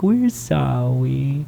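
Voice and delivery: mock apology. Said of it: "and he's like".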